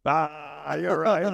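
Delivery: a hint of the drunk guy laughing; laughing concession. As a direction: chuckle through it, caving in. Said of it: "He goes".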